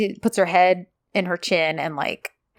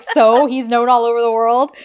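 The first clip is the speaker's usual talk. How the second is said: louder, mock-unimpressed echo; all-caps retort; 2 semitones higher, 5 dB louder than usual. [name] answering her praise of the architect, all-caps retort. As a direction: push it out loudly, imitating a dismissive retort. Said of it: "put this in all caps".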